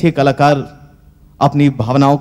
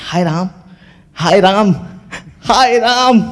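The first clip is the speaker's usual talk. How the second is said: panicked wail; 5 semitones higher than usual. Acted. Hanuman falls, rope let go.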